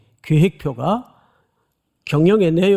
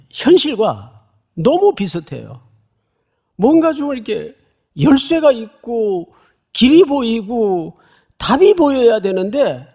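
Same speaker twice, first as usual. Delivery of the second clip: ordinary talk, nothing marked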